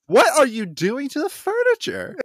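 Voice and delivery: very high, loud mock outrage; scandalized. Renfield waking to vampire women, scandalized mock cry. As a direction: cry out in hammed-up alarm over the trifle. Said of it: "his first thought is not like".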